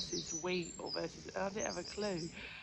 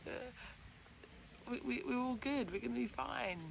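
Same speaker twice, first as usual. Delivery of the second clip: lightly higher, carefree reassuring; breezy reassurance; 7 semitones higher than usual. Retold or imitated. Imitating her usual calm self reassuring Mark, breezy. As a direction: wave the worry away. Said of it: "I'm normally like".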